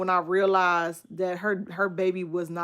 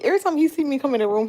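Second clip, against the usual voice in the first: usual speaking voice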